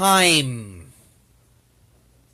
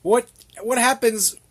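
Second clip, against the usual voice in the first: usual speaking voice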